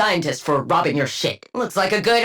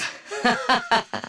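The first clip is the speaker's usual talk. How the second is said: dry chuckle; 3 semitones higher, 4 dB quieter than usual. Acted. Laughs at own office joke.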